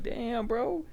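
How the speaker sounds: high-pitched mock disbelief; retold astonishment. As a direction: act out being amazed at the bartender. Retold astonished reaction to bartender.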